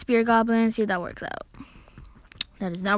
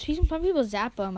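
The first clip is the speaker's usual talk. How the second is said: ordinary talk, nothing marked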